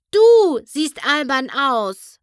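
a filly scolding Discord; angry retort. A narrator's reading. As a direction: throw his words back at him. Apple Bloom scolding Discord.

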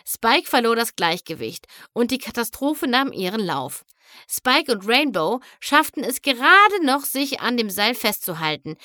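ordinary delivery

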